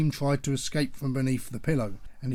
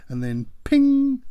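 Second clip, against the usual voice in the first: high, stretched microwave ping; bright drawn-out ding of the cooker; imitated ping; 12 semitones higher, 7 dB louder, slower than usual. A narrator's reading. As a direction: sing out the oven's ping. Microwave ping sound effect.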